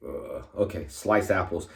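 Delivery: a hint of a bored cashier repeating back; mock cashier.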